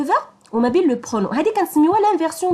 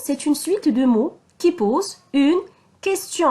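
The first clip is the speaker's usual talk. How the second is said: slow dictation; much slower than usual. Shown as a demonstration. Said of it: "dictating definition".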